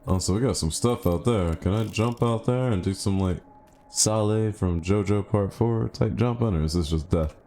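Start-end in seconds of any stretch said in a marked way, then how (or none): none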